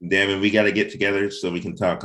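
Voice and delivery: ordinary talk, nothing marked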